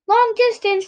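high squawking bird voice; parrot voice. Acted. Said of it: "replied Frank".

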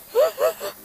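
high, hammed-up crying gasp; mock sobbing gasp. She imitates her own ugly crying, high gasp. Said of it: "I'm ugly crying".

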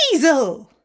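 high, loud shriek of alarm; startled guards yelp the word. A narrator's reading. Guards spotting the weasel shriek it out loud. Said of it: "humans rounded the corner and shrieked".

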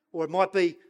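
ordinary talk, nothing marked

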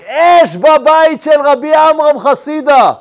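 high, loud cry of fire at his own house; shouting fire. Retold or imitated. Rabbi calling the townspeople from the ladder, loud high shout. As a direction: call the whole town to come, loudly. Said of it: "הוא עומד באמצע הסולם וצועק".

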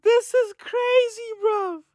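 can't believe what he just heard; high, cracking disbelief; incredulous. Real, not acted. Disbelief at the story.

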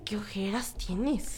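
slow, soft dismayed murmur; tired murmur. Retold or imitated. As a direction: mutter it at the mirror, half awake. Talking to mirror in morning.

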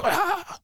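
short, very high "ah" of sudden anger; mock yell. Retelling blowing up at a soldier, high mock yell. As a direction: flip from calm to exploding in one burst.

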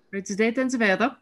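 lighter Austrian teacher voice; Austrian accent. Retold or imitated. German teacher repeating to the class in Austrian accent. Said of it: "I think I can do an attempt at this accent".

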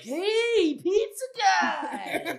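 high, loud, very slow calling out; calling drunk girls. Retold or imitated. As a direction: call out like a tipsy party girl.

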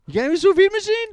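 high, loud, fast disbelief; incredulous. Real, not acted. Friend hears of a sous vide plan, incredulous squeal. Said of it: "disbelief at friend's plan".

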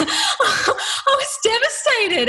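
slow, high, laughing through the words. Real, not acted. Telling how she thought she failed, slow high laughter. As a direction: laugh your way slowly through the line. Laughing while recalling the shock.

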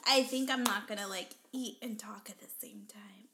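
fading into a breathy whisper after choking; out of breath. Real, not acted. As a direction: still recovering from choking, whispering. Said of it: "I'm still, like, trying to catch my breath".

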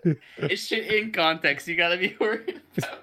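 high, loud words through real laughter; laughing while talking. Real, not acted. Joking about episodes taken out of context, laughing hard. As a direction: let the laugh push the voice up high. Cracking up at own joke.

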